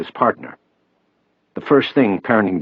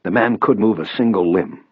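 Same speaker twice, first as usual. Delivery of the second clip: ordinary delivery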